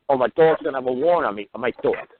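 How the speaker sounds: normal talking voice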